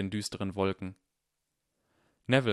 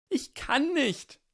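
high, drawn-out desperate cry; 3 dB louder, much slower than usual. Acted. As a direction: stretch it out high in despair. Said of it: "seine Stimme so verzweifelt".

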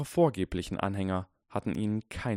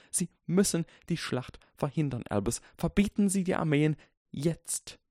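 higher, urgent plea; urgent professor; 9 semitones higher than usual. A narrator's reading. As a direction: insist firmly to the headmaster. Minerva pleading urgently.

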